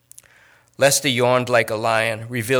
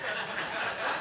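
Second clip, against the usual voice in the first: soft breathy laugh; 11 semitones higher, 15 dB quieter than usual. Acted. Laugh after dodge.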